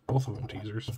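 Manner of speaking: ordinary talk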